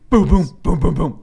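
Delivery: loud booming punch noises; mock punch sounds. GM describing punches, loud booming sound effects. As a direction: boom out each hit like a cartoon fight.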